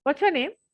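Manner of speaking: "What's" hit high and sharp at the start; brusque demanding question. Shown as a demonstration. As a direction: snap the question out with the pitch jumping up on What's. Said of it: "एक ये tone हुआ".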